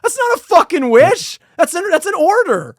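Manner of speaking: loud, high-pitched comic indignation; outraged rant. Real, not acted. Host objects that it's not a wish, outraged. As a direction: rant in exasperated disbelief at the nonsense.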